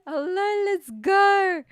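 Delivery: high, loud streamer greeting; hyped shout. Retold or imitated. Hyped-up stream greeting after rushing.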